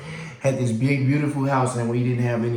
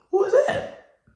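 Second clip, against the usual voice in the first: shrill, much higher startled cry; alarmed who is that. Retold or imitated. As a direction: jump at the strange voice. Startled by man's voice.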